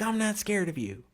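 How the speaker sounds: high-pitched mimicry of a kid; little kid. Little kid sizing up a man in line, high mocking imitation. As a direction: imitate a defiant child in a high voice. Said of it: "there was this little kid".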